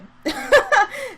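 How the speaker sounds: sudden high laugh; real laugh breaking out; burst of laughter. Real, not acted.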